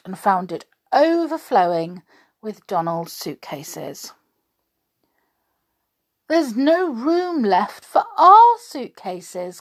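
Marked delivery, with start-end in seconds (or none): 6.3-9.6 higher, louder and slow, stressing our; dismayed Daisy crying that there's no room left; a panicked wail over a packed car. Acted. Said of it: "cried Daisy".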